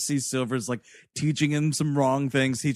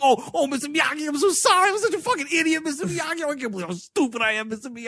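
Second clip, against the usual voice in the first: much higher, fast, whiny groveling; Scotty from Boogie Nights; 9 semitones higher, much faster than usual. Retold or imitated. LaRusso groveling to Miyagi like a pathetic kid. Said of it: "he's fucking Scotty from Boogie Nights".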